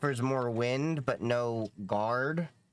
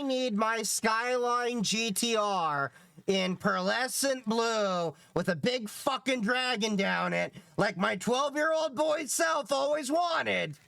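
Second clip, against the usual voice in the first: excited; 9 semitones higher, slower than usual. Real, not acted. Gushing about dream car.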